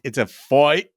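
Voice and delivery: "fight" said "foyt", Aussie style; Australian fight. Shown as a demonstration. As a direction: turn the I in "fight" into an oy. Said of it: "kinda O-y, but kinda U-y".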